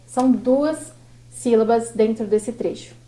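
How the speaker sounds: ordinary talk, nothing marked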